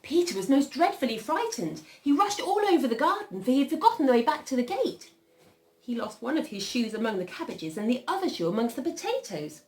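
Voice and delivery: animated storytelling, high then settling; story narration. A narrator's reading. Reader telling children of frightened Peter, animated storytelling. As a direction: start the chase high and urgent, ease off gradually. Reads a picture story aloud.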